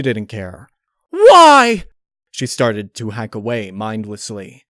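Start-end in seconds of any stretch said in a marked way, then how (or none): 1.1-1.8 piercing high shout, very loud. Acted. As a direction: scream it again. Repeated shout while stabbing.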